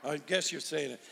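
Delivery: soft muffled mock mumble with a laugh; mumbling through a mask. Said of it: "Y'all mumbling through a mask".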